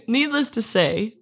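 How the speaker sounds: ordinary delivery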